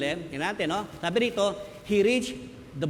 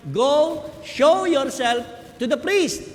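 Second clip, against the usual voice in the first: high, loud, slow command; a firm command from Jesus; 7 semitones higher, 7 dB louder, much slower than usual. Retold or imitated. Jesus answering the ten lepers, firm raised command. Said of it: "Ang sabi ni Jesus Christ".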